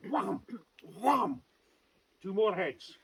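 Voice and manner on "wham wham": a loud, high punched wham; sword strike. Acted. Storyteller acting out Macomie's sword blow, loud punchy wham. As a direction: hit it like the blade landing. Two more sword strokes.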